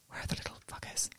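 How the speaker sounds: barely audible whispered curse. Retold or imitated. Mum whispering while sneaking up on her kids. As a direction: hiss it under your breath. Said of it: "And I was like".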